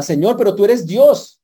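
high, loud, slow plea to God; desperate cry to the Lord; pleading believer. Acted. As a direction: beg the Lord, stretching each word. Sick person pleading with God.

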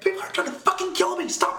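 high-pitched, rushed frantic plea; acted-out desperate outburst; imitated frantic yelling. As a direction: yell it like a panicked caller. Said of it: "so she starts yelling".

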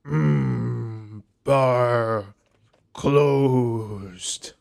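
lightly voiced slow orc; a hint of a bored orc bouncer. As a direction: orc turning them away, understated grunt. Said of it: "the orc says".

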